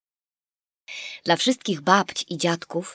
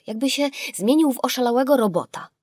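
ordinary delivery, nothing marked

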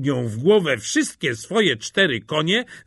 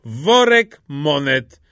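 loud, heavily drawn-out words; stretched, weighty declaration; slow, emphatic answer; 6 dB louder, much slower than usual. A narrator's reading. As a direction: state the price slowly and firmly. Hawker repeats his steep price.

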